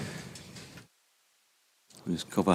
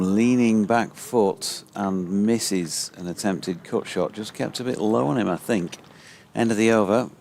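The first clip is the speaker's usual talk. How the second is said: louder, higher call of a near miss; excited cricket commentary; 3 semitones higher, 5 dB louder than usual. Acted. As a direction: ride the tension of the play-and-miss. Radio cricket ball-by-ball.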